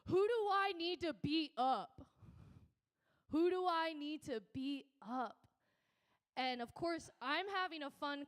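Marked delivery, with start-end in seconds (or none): 0.1-2.0 loud, slow, menacing bellow; tough guy threat. Retold or imitated. 3.3-5.4 loud slow challenge, stress on who; repeated threat. Retold or imitated.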